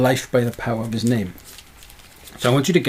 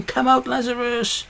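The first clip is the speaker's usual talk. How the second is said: mock shout; 11 semitones higher than usual. Voicing Jesus shouting Lazarus out of the tomb, high mock shout. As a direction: call it out as if shouting. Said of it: "he had a shout".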